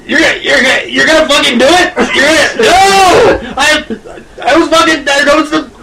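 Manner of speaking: put-on high panicked drunk uncle yelling. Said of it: "he doesn't sound like a drunk uncle".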